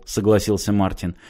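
ordinary delivery

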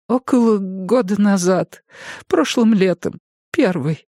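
высокий голос пожилой женщины; мягкая разговорчивая старушка. A narrator's reading. Jenny answers. (голос старушки)

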